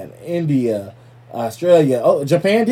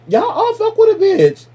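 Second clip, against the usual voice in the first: much higher, louder, delighted squeal; excited; 6 dB louder than usual. Real, not acted. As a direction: squeal it out to the fans. Excited shout-out to fans.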